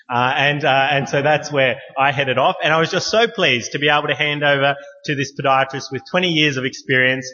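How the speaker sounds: ordinary delivery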